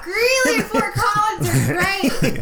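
high, loud, laughing cheer; mock hype shout. Podcaster jokingly hyping Colorado to listeners, hammed-up shout. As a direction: belt out fake hometown pride, drawn out and high.

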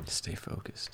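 whispered sign-off. Real, not acted. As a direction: breathe it out as a whisper. Echoing the sign-off motto.

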